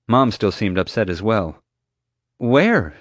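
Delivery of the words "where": high, loud demand. A narrator's reading. Upset mom questioning the guard about the move, demanding. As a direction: fire the question at them. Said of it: "she demanded".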